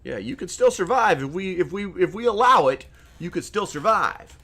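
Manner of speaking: half-hearted condescending lender tone; mild credit company. Retold or imitated. Credit companies lecturing customers, lightly mocking. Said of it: "they're gonna be like".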